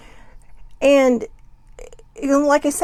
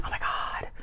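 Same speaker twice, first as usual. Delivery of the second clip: whispered dismay; 10 dB quieter than usual. Retold or imitated. Overwhelmed when the day is upended.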